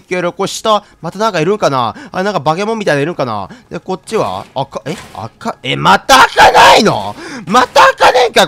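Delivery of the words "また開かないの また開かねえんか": slow, loud, high cry of exasperation; frustrated shout. Real, not acted. Frustrated shout at yet another locked door. Door won't open again.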